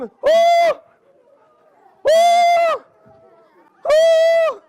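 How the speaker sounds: loud, very high yelps of pain; old lady yelping. Acted. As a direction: shriek like a struck granny. Old lady running from the gourd.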